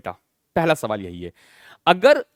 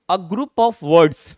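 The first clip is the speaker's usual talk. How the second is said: 1 mock student answer; 6 dB louder, much slower than usual. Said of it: "Mostly students ये कहेंगे".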